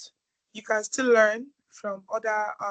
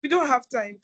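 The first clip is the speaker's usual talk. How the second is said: firm insistent; 4 semitones higher, 6 dB louder than usual. Real, not acted. Host cuts off speaker, insistent.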